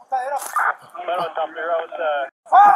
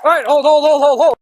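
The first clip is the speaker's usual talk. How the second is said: shouted police commands; 5 semitones higher than usual. Real, not acted. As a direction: yell it straight at the suspect. Officer yelling at suspect after shots.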